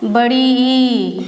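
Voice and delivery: बड़ी ई with the ई stretched long; teacher stretching ई slowly; long drawn-out vowel. Shown as a demonstration. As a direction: hold the ई to show a long vowel. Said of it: "दूसरा हो गया".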